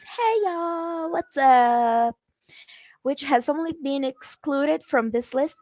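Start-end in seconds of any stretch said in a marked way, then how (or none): 0.0-2.2 light, casual "Hey, y'all" starting high; movie-style greeting. Retold or imitated. Lightly acted film character greeting his friends. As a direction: toss it off like a friendly movie Southerner. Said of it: "You hear it a lot in the movies".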